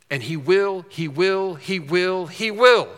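반복할수록 점점 세지는 "he will"; 마지막 "he will"은 높고 크게 터뜨리기; emphatic he will. Shown as a demonstration. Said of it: "it's very emphatic".

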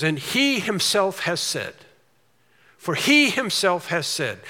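slow, weighty reading of scripture; slow and solemn Bible reading. Acted. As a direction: lean on every word of the verse.

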